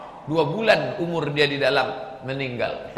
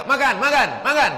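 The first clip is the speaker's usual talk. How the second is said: much higher, loud, insistent offer to eat; loud invitation; 10 semitones higher, 6 dB louder than usual. Retold or imitated. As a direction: boom the welcome at the guest. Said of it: "Itu suaranya keras".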